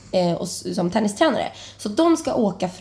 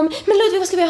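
high, louder desperate plea; retold panic; 10 semitones higher, 3 dB louder, much faster than usual. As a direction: plead in a panic. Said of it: "panikgråta".

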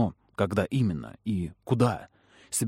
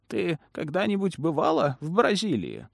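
sweet, sinister smiling crone; Ламия; 6 semitones higher than usual. A narrator's reading. Lamia speaking, same voice. (приторный голос старой ведьмы)

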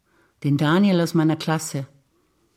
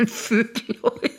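higher pitch, words squeezed out through giggles; real laughing; 7 semitones higher than usual. Real, not acted. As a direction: break into laughter mid-word. Cracks up at own double meaning.

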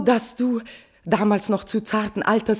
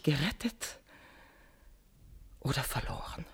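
much softer, lower, unvoiced; whispered doom; fading whisper; 5 semitones lower, 15 dB quieter than usual. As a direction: let it die away in a whisper. Queen ending confession.

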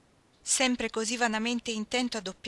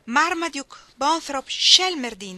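calling out his names; 7 semitones higher, 11 dB louder, slower than usual. Acted. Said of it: "gridò, in piedi accanto alla quercia".

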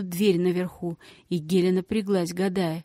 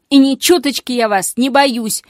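loud, higher defiant cry; defiant shout; 5 semitones higher, 9 dB louder than usual. Acted. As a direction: shout back without fear. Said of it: "выкрикнула Геля".

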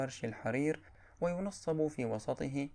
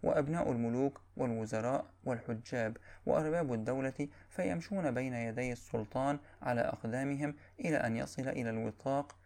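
ordinary talk, nothing marked

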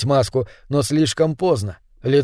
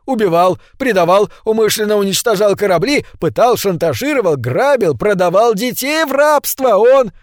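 louder and higher with each crime; building frantic list; 6 semitones higher, 3 dB louder than usual. A narrator's reading. Angmark listing crimes to the gnome, rising to a high loud cry. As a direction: pile the accusations up.

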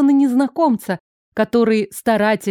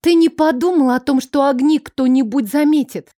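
high, loud angry snort; 5 semitones higher, 3 dB louder than usual. A narrator's reading. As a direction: snap at him angrily. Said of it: "рассерженно фыркнула София".

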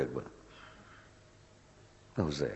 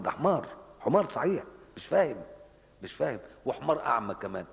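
angry scolding; 6 semitones higher than usual. Real, not acted. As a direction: denounce them with heat. Preacher scolding people who object.

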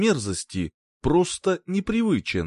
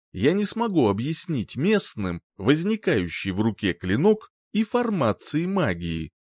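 usual speaking voice

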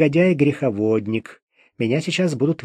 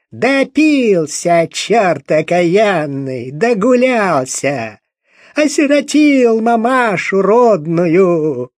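slow, louder keening of a mother cursing her son's drinking; lamenting wail; 5 semitones higher, 4 dB louder, slower than usual. Acted. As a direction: drag every word out like a village lament. Mother wailing over dead son. (мать причитает, медленно и громко)

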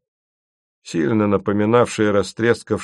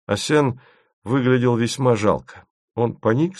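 plain reading voice

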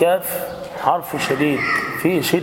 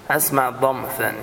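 usual speaking voice

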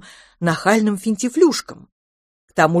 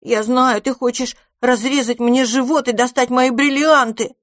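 hissing in panic; 7 semitones higher, 4 dB louder than usual. A narrator's reading. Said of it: "прошипел дракончик".

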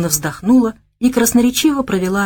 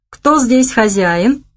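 slow, higher, mildly sly question; 5 semitones higher, 3 dB louder, much slower than usual. A narrator's reading. Bogomolov nudging the club hostess to agree, mildly sly. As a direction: draw it out with a touch of cunning. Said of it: "хитро спросил Богомолов".

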